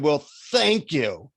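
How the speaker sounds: slow, sarcastic mock thanks; sneering. Annoyed seeker thanking the guru, sneering. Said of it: "I sneered and I said".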